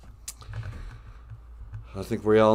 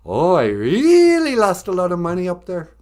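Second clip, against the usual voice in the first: higher, louder mock Red Lake accent; 6 semitones higher, 6 dB louder than usual. Shown as a demonstration. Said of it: "I can do Red Lake".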